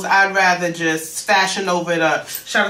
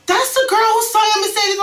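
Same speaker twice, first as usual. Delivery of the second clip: high, loud excited voice; imitating her, pitched up and loud; retold answer; 5 dB louder than usual. Retelling the friend's reply, high and loud. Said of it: "She's like".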